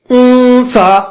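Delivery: اون سا with a long nasal hum before سا; nasal ghunna. Shown as a demonstration. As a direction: hold the n of اون سا in the nose, tongue not touching. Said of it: "ناک سے آواز نکالیے".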